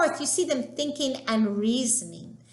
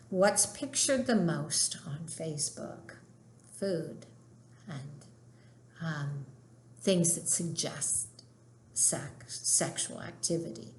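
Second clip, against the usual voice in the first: a hushed, conspiratorial whisper; whispered aside; 4 semitones lower, 7 dB quieter than usual. Real, not acted. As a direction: lean in and whisper it like a shared secret. Conspiratorial aside about Facebook.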